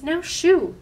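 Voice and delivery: high, loud shoo. Acted. Wolf shooing Fern away from the sleeping pigs. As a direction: brush her off sharply.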